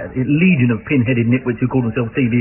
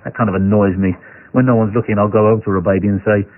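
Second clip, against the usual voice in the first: low, deadpan comedian voice; dry, downbeat stand-up delivery; Stephen Wright; 5 semitones lower than usual. Acted. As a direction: deliver the one-liner in a low monotone. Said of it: "I'll do Stephen Wright's voice".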